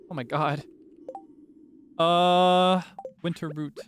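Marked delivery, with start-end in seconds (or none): none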